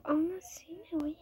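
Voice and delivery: hushed sneaking whisper. Real, not acted.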